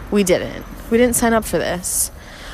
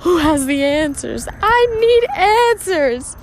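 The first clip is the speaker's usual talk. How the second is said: whiny and much higher, stretched out; desperate plea; 10 semitones higher, 3 dB louder, slower than usual. Retold or imitated. Ranting to her boyfriend on the patio, hammed-up high wail. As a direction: play it up like a desperate soul begging. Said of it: "And I'm like".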